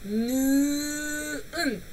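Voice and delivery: slow, drawn-out 느은; 느은 stretched out slowly, syllable by syllable. Shown as a demonstration. Teacher drills the 는 syllable for students, slowly. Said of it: "Esa pronunciación".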